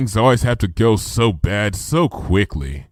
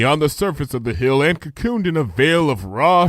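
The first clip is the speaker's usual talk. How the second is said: plain reading voice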